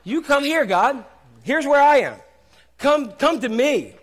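loud, high summons; mock haughty believer; demanding worshiper. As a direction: order God over like a cat. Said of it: "So we tell God".

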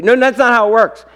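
ordinary delivery

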